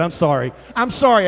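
normal talking voice

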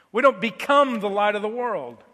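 normal talking voice

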